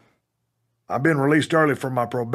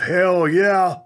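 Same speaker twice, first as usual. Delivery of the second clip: mildly shouted crowd reply, pitched up; higher imitated audience cheer; crowd response; 5 semitones higher, 4 dB louder than usual. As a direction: answer back like a rallied arena. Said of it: "the audience responds with".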